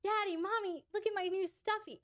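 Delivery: put-on high, giddy child voice; little showing the stuffy to its caregiver, high and giddy; excited little. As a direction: play the excited little showing off a new toy. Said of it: "and they're like".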